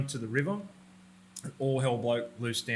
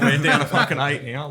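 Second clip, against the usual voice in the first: laughing; 3 semitones higher, 13 dB louder than usual. Real, not acted. Repeats count laughing.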